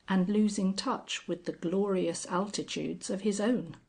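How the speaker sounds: ordinary delivery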